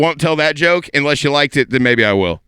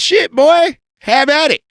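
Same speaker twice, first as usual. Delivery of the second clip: much higher, louder yee-haw holler; hollered cowboy encouragement; 11 semitones higher, 5 dB louder than usual. Retold or imitated. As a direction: whoop it like a rowdy cowboy. Acted cowboy egging on friend.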